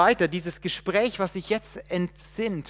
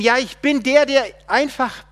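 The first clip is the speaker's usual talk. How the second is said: very loud, high answer; emphatic God; 7 semitones higher, 8 dB louder than usual. Acted. God answering the man, very loud and high. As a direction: declare it, searching for the word.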